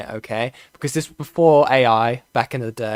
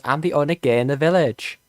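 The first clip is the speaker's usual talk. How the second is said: Little Britain line. Retold or imitated. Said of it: "the line where he goes".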